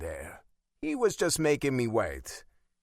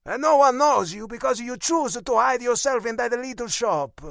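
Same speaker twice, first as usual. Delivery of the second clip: put-on loud, high Italian-accented scolding; scoffing Italian accent; 13 semitones higher, 8 dB louder than usual. A narrator's reading. Father scolding his son for hiding, loud Italian accent. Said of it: "he scoffed".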